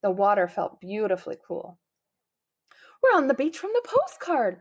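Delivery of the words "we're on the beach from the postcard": high, gleeful exclamation; excited kid at the beach; delighted. A narrator's reading. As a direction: call out happily while splashing in the sea. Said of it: "Bob laughed as he splashed".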